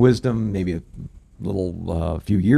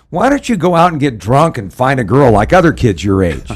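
retold fed-up outburst, higher and louder; exasperated dad; 4 semitones higher, 7 dB louder than usual. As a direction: imitate a father at the end of his patience. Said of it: "he was really exasperated".

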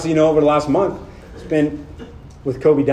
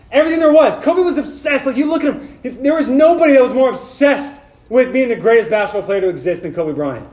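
higher, louder, driving preacher's voice; full-force preaching; 7 semitones higher, 4 dB louder than usual. Acted. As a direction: preach it out to the room, rising and urgent.

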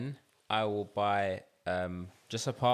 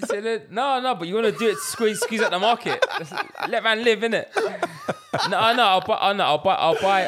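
laughing loudly while talking; louder, laughing through the words; excited laughing; 12 semitones higher, 9 dB louder than usual. Real, not acted. Excited laughing back-and-forth.

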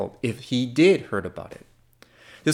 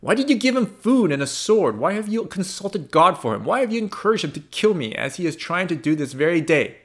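angry accusation; 6 semitones higher than usual. A narrator's reading. Saul's accusations continue.

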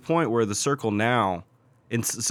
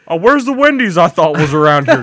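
mock lost driver; 8 dB louder than usual. Imitating lost driving buddy.

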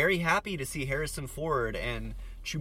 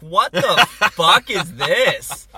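incredulous; 5 semitones higher, 15 dB louder, much slower than usual. Retold or imitated. Baffled at a 3D gimmick.

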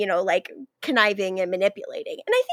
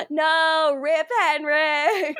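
drawn-out, higher-pitched fake sob; mock wailing; 5 semitones higher, much slower than usual. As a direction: mourn him in a hammed-up, slow wail. Mock mourning a dead character.

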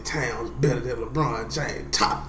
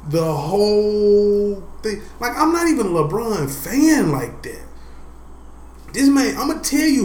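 loud opinionated ribbing; 6 semitones higher, 3 dB louder than usual. Real, not acted.

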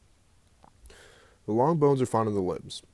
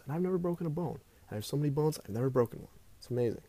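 ordinary talk, nothing marked

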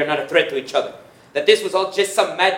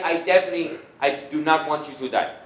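ordinary talk

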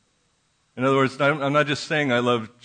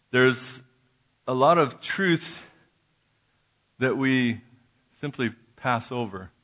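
ordinary talk

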